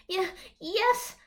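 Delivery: high, loud flustered stammer. Acted. Answering the alpha, high and flustered. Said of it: "I stammer".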